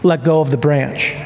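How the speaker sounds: low, even instruction as God. Acted. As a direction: give the order calmly, pitched low. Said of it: "And so God says to Jack".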